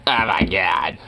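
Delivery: low mock "smug heckler" voice. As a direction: lightly imitate a smug woman in the audience. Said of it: "that was so goddamn smug".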